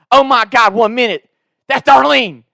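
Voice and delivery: hammed-up Southern husband in alarm; mock alarm. Prank caller says his wife is puking, mock alarm.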